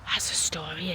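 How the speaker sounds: breathless gasped whisper; awed whisper. Real, not acted. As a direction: whisper it in amazement. Gasps at the memorial story.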